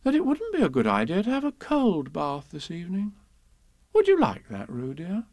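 high, lilting motherly musing; teasingly pondering mother voice; thoughtful. Acted. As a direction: wonder aloud to a small child. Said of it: "said Kanga in a thoughtful voice".

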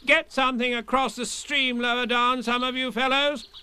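high, urgent command; calling out. Acted. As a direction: call out orders. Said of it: "called Rabbit".

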